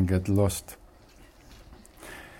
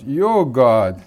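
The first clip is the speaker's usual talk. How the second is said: shouting; 4 semitones higher, 4 dB louder than usual. Retold or imitated.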